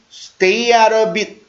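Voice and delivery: slow, linked "stay out of it"; slow pronunciation model. Shown as a demonstration. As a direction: stretch it out for learners to copy. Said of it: "발음을 한번 연습할게요".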